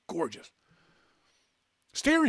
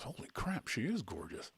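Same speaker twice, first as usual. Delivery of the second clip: hushed aside; 10 dB quieter than usual. Retold or imitated. Host retells friend's hushed reaction.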